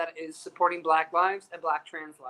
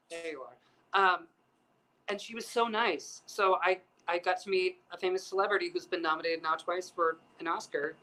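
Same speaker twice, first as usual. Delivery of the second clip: ordinary delivery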